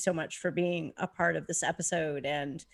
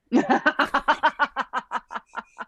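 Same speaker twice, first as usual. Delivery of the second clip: loud cackling belly laugh that tapers off; long, real ha-ha-ha laugh; big laugh; 3 semitones higher, 4 dB louder than usual. Real, not acted. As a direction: burst out laughing, then let it trail away. Laughing at own punchline.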